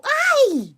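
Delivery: very high loud yelp. Real, not acted. Gamer yelping in shock, very high loud yelp. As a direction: cry out in shock.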